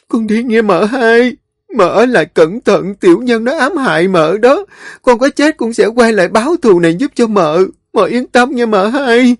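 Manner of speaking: high, choked goodbye; tearful farewell. A narrator's reading. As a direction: say goodbye through tears. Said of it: "khóc khan tiếng".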